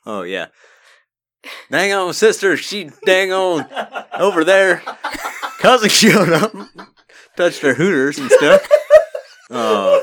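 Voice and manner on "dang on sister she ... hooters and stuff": mock Florida accent. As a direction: holler it in a hammed-up Florida twang. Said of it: "How about Florida?".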